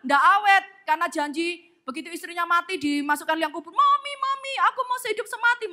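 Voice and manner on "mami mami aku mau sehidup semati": high-pitched, hammed-up widower's lament; comic bereaved husband; mock graveside wailing. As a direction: wail melodramatically for a dead wife.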